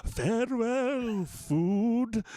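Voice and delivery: slow, high, theatrical vampire farewell. Acted. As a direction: draw it out like a hammy vampire. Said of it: "Do it in your vampire voice".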